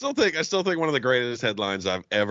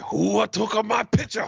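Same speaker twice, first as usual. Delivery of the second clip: higher, slow put-on Japanese accent, the way the joke needs it; mock Japanese accent; 8 semitones higher, slower than usual. Correcting a friend's punchline, in a hammed-up Japanese accent. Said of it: "you gotta do the accent right".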